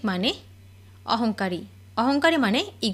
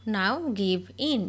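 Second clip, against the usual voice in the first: very slow, word by word; each word drawn out for learners to copy; slow dictation; much slower than usual. Shown as a demonstration. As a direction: spell it out slowly for the class. Repeated slowly for learners.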